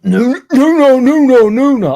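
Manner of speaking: squeaky high, loud American "no, no" whine; mock American whine. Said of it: "Obviously not in a Yorkshire accent".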